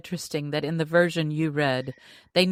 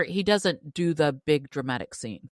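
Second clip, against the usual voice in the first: ordinary delivery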